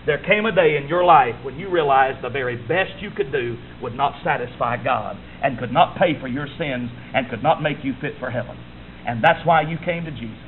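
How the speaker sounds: low earnest appeal. Real, not acted.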